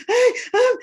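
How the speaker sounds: high, loud gasping sobs; imitating sobbing daughter. Mother imitates her crying daughter at school, high gasping sobs. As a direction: mimic a child too upset to speak.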